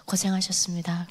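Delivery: softly whispered thanks. Retold or imitated. 간호사가 안아주며 속삭이듯 건네는 인사. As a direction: breathe it into her ear. Nurse hugs her goodbye.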